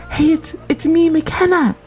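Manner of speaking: ordinary talk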